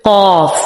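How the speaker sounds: قاف heavy with a straight alif vowel; correct heavy qaf. Shown as a demonstration. Teacher giving the correct qaf. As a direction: say قاف, not قوف. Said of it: "قوف نہیں بلکہ".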